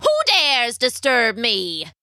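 theatrical witch's challenge; affronted witch. A narrator's reading. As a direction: demand it slowly as an offended witch. Witch angered by an intruder.

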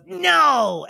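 high playful goblin no; gleeful goblin. Acted. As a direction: squeal it like a teasing goblin. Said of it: "He goes".

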